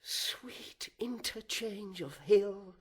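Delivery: slow whisper full of bitter longing. Acted. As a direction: breathe it out slowly as a whisper. Brooding villain soliloquy.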